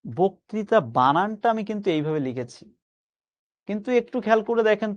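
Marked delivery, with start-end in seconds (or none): none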